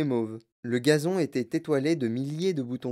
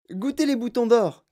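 higher, louder eager invitation; excited cry; 8 semitones higher, 5 dB louder than usual. A narrator's reading. Wonka urges guests to eat buttercups, calling out. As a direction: call out to the whole group. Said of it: "cria monsieur Wonka".

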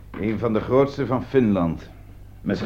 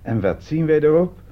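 higher and louder, building to the punchline; triumphant reveal; 8 semitones higher, 7 dB louder than usual. Acted. Detective springing the trap on the suspect, triumphant. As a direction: read the listing out like a winning card.

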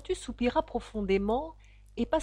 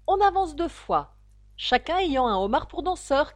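louder, higher dance call; 5 semitones higher, 6 dB louder than usual. A narrator's reading. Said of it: "cria le griffon". (crier les pas de danse)

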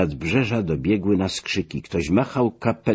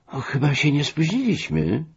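high-pitched, lightly self-satisfied remark; breezily pleased, pitched well up; pleased with himself; 8 semitones higher than usual. A narrator's reading. Latecomer stepping into the motorboat with his guests, pleased with himself. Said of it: "rzekł zadowolony Biesiadecki".

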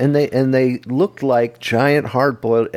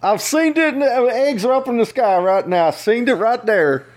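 a much higher Southern yokel calling his wife; Tennessee accent. Pretend. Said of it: "This is Minnesota, not Tennessee".

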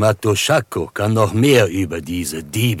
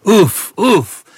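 sharp, much higher, louder exclamation of surprise; astonished uff; 12 semitones higher, 9 dB louder than usual. Acted. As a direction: burst out in amazement. Hears the name confirmed, astonished.

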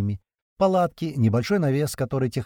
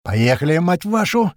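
shout; 5 semitones higher, 5 dB louder, slower than usual. A narrator's reading. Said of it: "крик маленького".

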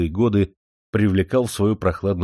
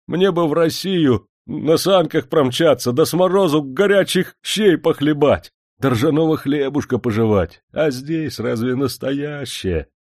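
dream aloud of home with aching longing; a homesick servant pining for Russia; yearning; 7 semitones higher, 4 dB louder than usual. A narrator's reading.

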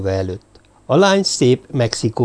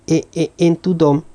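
higher voice, tripping on the first word; hasty, stammered agreement; 6 semitones higher than usual. A narrator's reading. Girl hurriedly nodding agreement to Zombori, stammering. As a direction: stammer into a quick assurance. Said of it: "bólintott sietve a lány".

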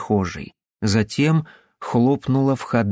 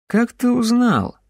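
eerie question; 12 semitones higher than usual. Acted. Henrik asks into space.

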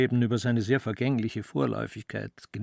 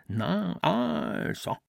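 much higher, rising mock of the father; he parrots his father's tone to torment himself, high and rising; a higher, self-tormenting echo of the father's voice; 7 semitones higher, much slower than usual. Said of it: "sich damit zu quälen".

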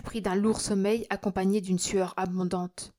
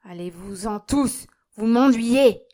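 building to a loud shout; angry dismissal; 2 semitones higher, 9 dB louder, slower than usual. A narrator's reading. Old miser throws out priest and family, shouting. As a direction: drive them out, louder on the end. Miser angrily sends everyone away.

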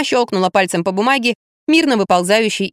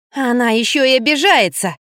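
plain reading voice

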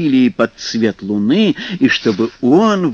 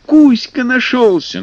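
excited; 11 semitones higher, 4 dB louder than usual. Acted. Mermaids shout joyfully.

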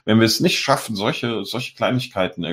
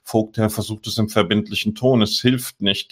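normal talking voice

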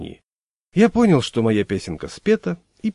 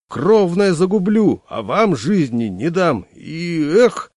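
high, loud, slow rampaging yell; shouting; 8 semitones higher, 5 dB louder, slower than usual. Acted. Landlord shouting at the family while wrecking walls, high, loud and slow. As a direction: roar it while swinging the crowbar. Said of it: "кричал он".

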